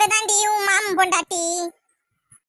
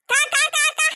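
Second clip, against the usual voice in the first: crow calls; 7 semitones higher than usual. Acted. Calling crows again.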